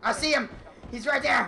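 loud and high alarm; excited shout. Real, not acted. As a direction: call out the enemy. Spotting enemy in game.

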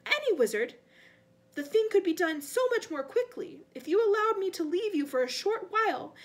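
high, loud, frantic pleading; rising squeakily. A narrator's reading. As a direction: protest squeakily to your master. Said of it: "his voice rising squeakily".

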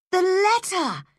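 high, sudden cry of realisation; urgent cry. A narrator's reading. Anne remembering the letter Matthew received, urgent cry. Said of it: "cried Anne".

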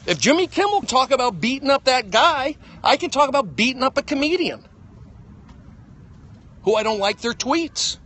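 loud, high, heated rant; angry rant. Real, not acted. Ranting at a comedian who dissed him, loud and high. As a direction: shout it out, fed up.

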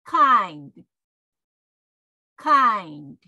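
emphatic English "kind", one syllable, d lightly released; English pronunciation model. Shown as a demonstration. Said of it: "Repeat after me".

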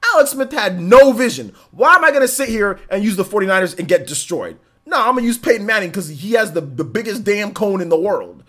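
loud, high, heated rant; fired-up gaming rant. Real, not acted. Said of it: "I think I've ranted on".

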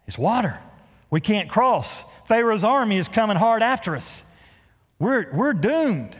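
hammed-up fear of the army; mock panic. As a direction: play the trapped crowd, mildly alarmed. Israelites panicking at the sea.

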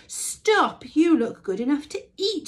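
loud, high snorted demand; snorting pig. A narrator's reading. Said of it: "snorted the pig".

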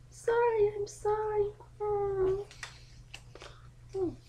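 high, soft, small "Sorry, I'm sorry"; tearful filly apology. Acted. Daughter apologizes to her dad, small and contrite. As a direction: whimper the apology quietly.

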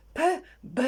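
П puffed short, Б held long; sound demonstration. Shown as a demonstration. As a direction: say П, then stretch out Б. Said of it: "Ось так почав заспокоювати". (розмірена вимова звуків П, Б)

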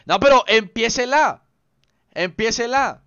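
high, loud, insistent demand; exigencia a gritos. Real, not acted. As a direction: shout for them to start it. Exige que empiecen la partida. (reclamo insistente, agudo y fuerte)

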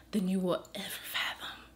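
choked up, barely getting it out; soft and breaking; overcome with emotion. Real, not acted. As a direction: voice falling away to a tearful whisper. Overcome while assuring her of love.

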